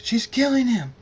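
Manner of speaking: high, loud, desperate scream. A narrator's reading. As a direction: scream in alarm for a friend. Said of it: "Draconic screams".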